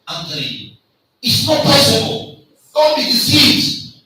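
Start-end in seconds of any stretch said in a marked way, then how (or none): 1.2-4.0 high, loud and slow warning; emphatic shout. Real, not acted. Lecturer warning students, loud emphatic shout. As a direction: drive the warning home, loud.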